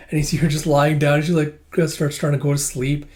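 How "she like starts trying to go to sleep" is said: ordinary delivery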